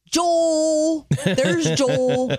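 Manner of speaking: imitating Glenn calling out the dog's name; how Glenn said it. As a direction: sing out the name like Glenn did. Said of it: "said his name like that".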